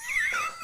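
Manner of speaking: high-pitched, soft laugh. Real, not acted. Host cracking up at friend stealing first, laughing. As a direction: burst of helpless high giggling.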